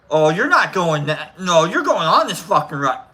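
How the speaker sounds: loud, forceful retold order; insistent friend. Friend Al at the roller coaster, loudly insisting. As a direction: insist loudly, punching the words.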